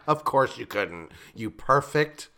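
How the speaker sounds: ordinary talk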